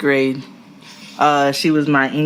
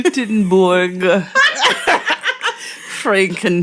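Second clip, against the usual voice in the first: put-on German accent; 4 semitones higher, slower than usual. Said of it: "Was that your German accent?".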